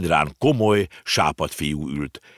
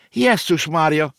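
high, slow startled cry; exclaiming in shock; 11 semitones higher, 6 dB louder, slower than usual. A narrator's reading. Girl cries out on hearing of the uncle's fate, shocked. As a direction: gasp it out in alarm. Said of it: "kiáltott fel a kislány".

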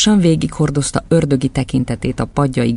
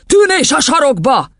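high, forceful scolding voice; tyrant headmistress, threatening; 13 semitones higher, 4 dB louder than usual. Acted. Headmistress to a boy answering back, high scolding. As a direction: threaten the child in a high yell.

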